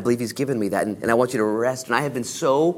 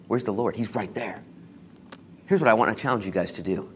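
normal talking voice

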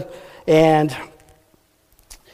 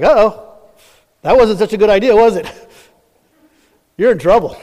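louder, higher teasing uh-oh; wife chiding Haman, higher and louder; mocking tease; 5 semitones higher, 6 dB louder than usual. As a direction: rub it in with a laugh.

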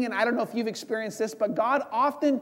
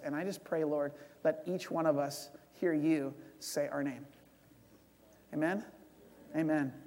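soft, hushed prayer voice; 5 semitones lower, 6 dB quieter than usual. Real, not acted. Pastor praying over the congregation, softly. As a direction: pray quietly with the congregation.

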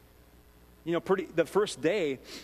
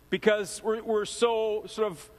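ordinary talk, nothing marked

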